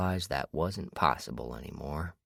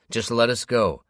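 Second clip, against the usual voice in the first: louder pleading shout; 8 semitones higher, 8 dB louder than usual. A narrator's reading. James shouts at the captor to release them, loud plea. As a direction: call out to him, begging hard. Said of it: "James shouted at him".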